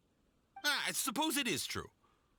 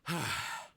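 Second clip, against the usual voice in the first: relieved sigh; 4 semitones lower than usual. Acted. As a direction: let the tension out. Merchant relieved after verdict.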